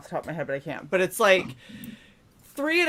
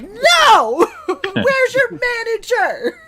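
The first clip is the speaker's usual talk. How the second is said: shrill high demanding customer yelling; Karen voice; 9 dB louder, much slower than usual. Retold or imitated. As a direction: shriek it like an entitled Karen. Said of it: "like, Karen it".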